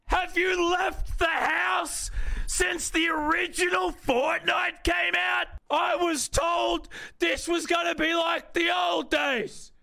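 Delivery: high-pitched yelling. Real, not acted. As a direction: yell it out in exasperation. Yelling at sweaty players.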